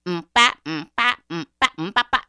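loud percussive "mm-ba" chant; rhythmic refrain. Acted. Storyteller beating out a drum refrain between lines, chanted. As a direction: beat it out like a drum.

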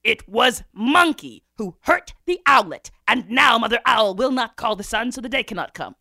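loud, forceful pronouncement, stretched at the end; king's proclamation. A narrator's reading. Lion king passes judgment on Monkey, loud decree. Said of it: "And the king said".

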